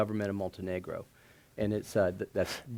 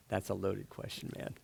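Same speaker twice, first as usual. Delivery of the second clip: normal talking voice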